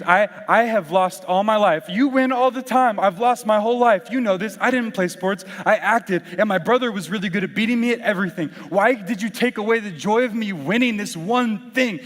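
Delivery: exasperated, wounded protest; frustrated outburst. Retold or imitated. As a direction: imitate a hurt friend lashing out. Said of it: "legitimately frustrated".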